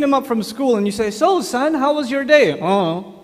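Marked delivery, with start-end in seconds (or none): 1.1-2.5 higher, louder acted friendly question; chatty father. Father picks up teenage son from school, eagerly chatty. 2.5-3.1 acted sullen brush-off, dropped in pitch; uncommunicative teenager. Teenage son answers dad after school, uncommunicative.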